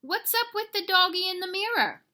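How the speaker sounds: high, loud storybook puzzlement; astonished question. Acted. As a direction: demand to know who that doggy is. Hero startled by his reflection.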